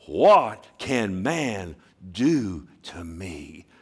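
drawn out very slowly; slow emphatic. Real, not acted. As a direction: let each word land. Slow climax of scripture quote.

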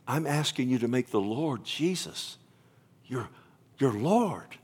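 ordinary talk

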